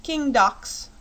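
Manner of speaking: "King Dox" high and bright; boy answering the fox king, lightly childlike; eager child answer. Acted. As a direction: blurt it like a quick little boy. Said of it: "said the boy".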